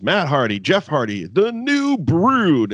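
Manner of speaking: high, drawn-out announcer call; hammed-up announcer intro; mock ring announcer. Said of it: "the way Lillian Garcia announces".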